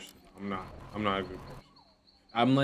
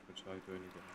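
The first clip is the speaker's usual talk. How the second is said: much softer, half to herself; muttered to self; 3 semitones lower, 18 dB quieter, faster than usual. Real, not acted. Streamer, confused, repeats the question softly to herself. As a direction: repeat it under your breath, puzzled.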